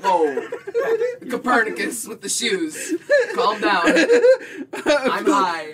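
laughing mock calm-down. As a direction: giggle through telling him to calm down. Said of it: "And I was just like".

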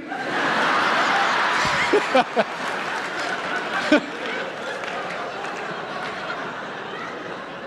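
long breathless laughter at the punchline; hearty laugh. Real, not acted. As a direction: laugh helplessly at your own joke.

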